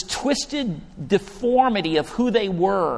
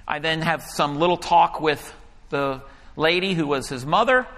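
normal talking voice

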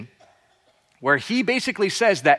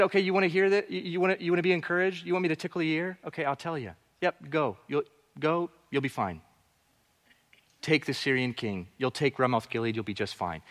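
softer and dismissive, half under the breath; sarcastic tone; 8 dB quieter, faster than usual. Retold or imitated. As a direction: brush him off with quiet sarcasm. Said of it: "Micaiah came with the sarcastic tone".

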